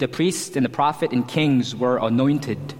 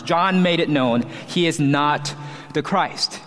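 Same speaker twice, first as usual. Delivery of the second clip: usual speaking voice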